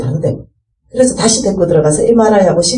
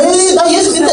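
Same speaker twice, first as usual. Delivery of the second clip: hasty high declaration; 9 semitones higher than usual. Retold or imitated. Startled roommate declares faith.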